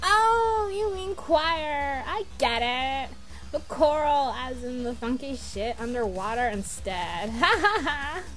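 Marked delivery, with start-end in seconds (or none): 0.0-2.0 drawn-out, high, louder "oh"; exaggerated lightbulb moment, stretched slow; sudden realization. Mock dawning realization. 7.4-8.2 pleased, higher-pitched chuckle; amused ha-ha; laughing at own joke. Acted. Laughs at own fish pun.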